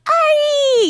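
high, drawn-out squeal; imitated fan scream. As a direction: scream the name like excited contestants greeting him. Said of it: "they got the Ari scream".

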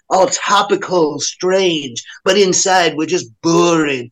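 drawling mockery of a self-absorbed scenester; mock self-important hipster. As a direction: imitate someone precious about being special. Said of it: "these motherfuckers act like".

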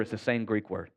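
ordinary delivery

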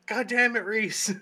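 loud, high mock cursing; exasperated outburst. Player voices a squashed character cursing Reese, loud exasperation. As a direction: cry out in hammed-up exasperation.

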